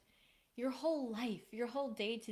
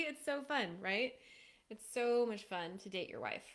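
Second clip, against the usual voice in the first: normal talking voice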